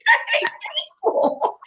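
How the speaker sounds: "That ain't stable" jumped way up high and thin, half whispered at the end; squeaky high repeat. Shown as a demonstration. Repeating her punchline to her co-host in a squeaky high voice. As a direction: squeak it back as a joke after laughing.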